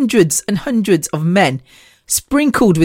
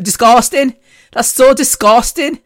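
higher-pitched mock Scouse, rising at the end; attempted Liverpool accent; 5 semitones higher than usual. Shown as a demonstration. Showing off the one Scouse word she can do, mock Liverpool accent. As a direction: try out a hammed-up Scouse lilt. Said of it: "I can't do a Liverpool accent".

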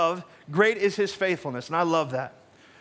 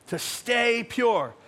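slow and emphatic; 3 semitones higher, much slower than usual. Shown as a demonstration. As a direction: stay pure drawn out very slowly. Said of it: "repeats slower".

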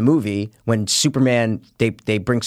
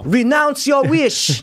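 slow, high-pitched heroine impression; hammed-up imitation of a movie heroine's plea; Wonder Woman; 11 semitones higher, much slower than usual. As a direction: stretch out a mock-dramatic demand, way up high. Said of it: "listen to you do Wonder Woman".

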